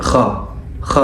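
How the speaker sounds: خ said as a rasped throat kh; German ch as kh. Shown as a demonstration. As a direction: scrape خ in the throat. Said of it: "بتنطق".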